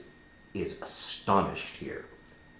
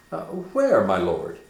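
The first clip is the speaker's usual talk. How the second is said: higher, louder, alarmed; startled question; 3 semitones higher, 6 dB louder than usual. Acted. Horatio startled, thinking Hamlet sees a ghost. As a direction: jump at the words.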